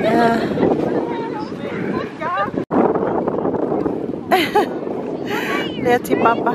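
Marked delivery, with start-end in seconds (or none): 0.4-5.8 loud laughter. Real, not acted. Laughing in the snowstorm.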